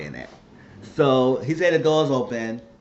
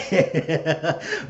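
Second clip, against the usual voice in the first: laugh; 5 semitones higher than usual. Real, not acted. Laughing at own rant.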